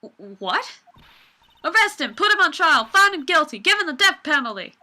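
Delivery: put-on a Southern girl hollering for an arrest; loud Southern accent. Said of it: "Lotta, what was your accent again?".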